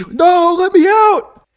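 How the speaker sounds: very high, loud, drawn-out wail; slow theatrical screech; mock desperate scream. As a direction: scream to be freed from a cage, hammed up. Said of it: "They're screaming".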